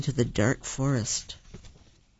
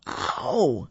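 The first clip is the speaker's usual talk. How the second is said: pained howl. A narrator's reading. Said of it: "a bee stung me".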